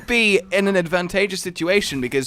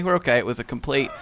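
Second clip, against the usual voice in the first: usual speaking voice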